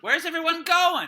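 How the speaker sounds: loud, slow call across the room. Real, not acted. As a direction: call out to people who wandered off. Calling to people who left.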